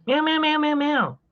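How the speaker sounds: very high little cat meows; nervous cat voice. Acted. Voicing cat begging for treat.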